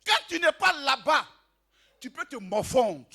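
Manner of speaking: loud, rising preacher's cry over the music; sermon shouted with fire; full-force preaching. Acted. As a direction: proclaim it to the whole hall.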